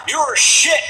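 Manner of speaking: high mock shout of a passing heckler; heckler yell. Heckler in a revolving restaurant shouting abuse, high mock yell. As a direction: yell it up at the stage.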